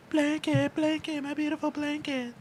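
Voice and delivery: high soft crooning; high breathy doting. Retold or imitated. [name] impression, doting.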